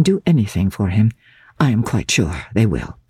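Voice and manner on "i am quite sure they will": ordinary delivery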